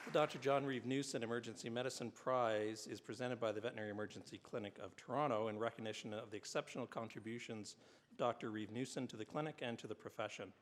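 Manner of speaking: formal ceremony announcer; award announcer. Acted. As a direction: read it like an awards presenter. Reads award citations at ceremony.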